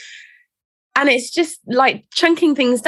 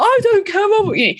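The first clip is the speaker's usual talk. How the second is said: put-on much higher, bratty teenage snap; stroppy teen snap; 9 semitones higher than usual. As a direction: throw it at mum like a hormonal fourteen-year-old. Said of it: "fourteen-year-old stroppy hormonal".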